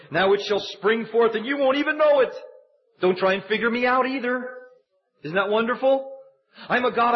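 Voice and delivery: high, fervent pulpit preaching; full-force preaching. Acted. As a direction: preach it with rising fire to the congregation.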